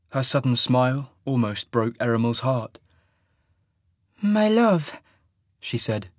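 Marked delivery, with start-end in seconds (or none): 4.2-5.0 high, lilting Tarbanese woman's voice; lilting Tarbanese accent. A narrator's reading. Waking woman greets her lover, lilting Tarbanese accent. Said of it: "in her lilting Tarbanese accent".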